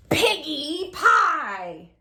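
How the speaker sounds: slow, loud drawn-out witch voice; greedy witch savouring piggy pie. Acted.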